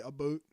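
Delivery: imitated Canadian vowel in "boot"; Canadian "about". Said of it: "Canadian people say".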